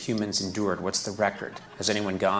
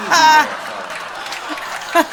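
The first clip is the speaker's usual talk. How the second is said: loud, high soothing toilet voice; talking toilet; 12 dB louder, faster than usual. Pretend. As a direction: call out gently, then loud. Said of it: "the voice of a new toilet".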